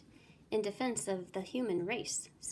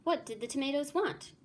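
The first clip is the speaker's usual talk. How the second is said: alarmed questions; 7 semitones higher than usual. A narrator's reading. Narrator asks in alarm.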